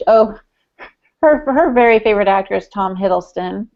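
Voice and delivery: normal talking voice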